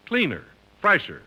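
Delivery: ordinary talk, nothing marked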